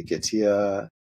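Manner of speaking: Gitia said as "git-tee-uh"; drawn-out Gitia with an added final "uh"; alternative pronunciation Gitia. Shown as a demonstration. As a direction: say Gitia in three syllables, ending in uh. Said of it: "some say Gitia".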